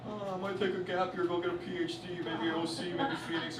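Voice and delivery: yapping bore. Retold or imitated. Guy yapping about a gap year to a polite listener, mock droning. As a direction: ramble on evenly like a tedious talker. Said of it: "Guy's yapping".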